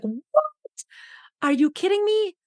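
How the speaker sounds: retold astonished outburst; surprised. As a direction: act out the shock of hearing it. Retelling her own shock.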